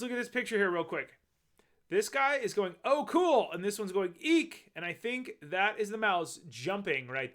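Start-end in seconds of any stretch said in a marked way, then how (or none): 2.8-3.5 mock exclamation, higher and louder; excited exclamation. Reader voices a character in the picture, excited. 4.2-4.7 high, louder little eek; mock fright. Reader voices a character spotting the shark, mock frightened.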